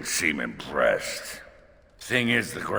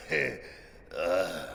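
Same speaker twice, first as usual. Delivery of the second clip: put-on drunk; 8 semitones higher than usual. Drunk toast to commander.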